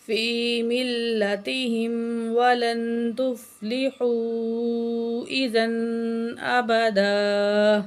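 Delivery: slow, melodic Quran chant; full verse recitation. Shown as a demonstration. Teacher recites verse nineteen of Kahf, slow chant. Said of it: "تو مکمل آیت پڑھتے ہیں".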